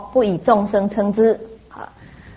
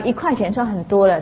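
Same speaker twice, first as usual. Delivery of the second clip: usual speaking voice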